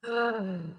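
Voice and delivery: soft, breathy long exhale; sighing out the breath; deep-breath sigh. Shown as a demonstration. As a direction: release a deep breath audibly. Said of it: "Deep breath".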